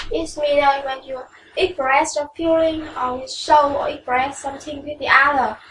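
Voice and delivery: normal talking voice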